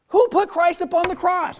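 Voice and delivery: high, loud, ringing call to the pews; called-out question. Real, not acted. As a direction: belt the question out to the room. Preacher calls question to congregation.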